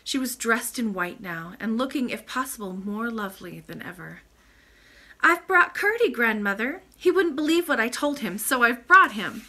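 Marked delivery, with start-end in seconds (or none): 5.2-9.4 put-on high, loud, excited child; little princess reporting proudly; eager young princess. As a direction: pipe up urgently, pitched well above usual.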